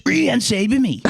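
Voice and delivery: high mock stranger voice; imitating stranger. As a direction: pitch up as the odd man asking. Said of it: "turned around to him and goes".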